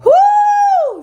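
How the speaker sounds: a sudden high, loud whoo to hold back tears. Real, not acted. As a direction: blow out hard and high to stop crying. Fighting back tears on camera.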